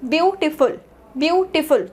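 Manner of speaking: "beautiful" as beau, tiny gap, tiful; gap between syllables. Shown as a demonstration. Teacher shows the mini-second gap to students, gap between syllables. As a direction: break "beautiful" briefly after the first syllable. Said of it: "कितने mini second का आपका gap".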